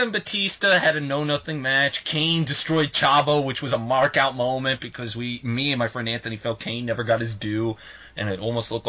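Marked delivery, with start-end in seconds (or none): none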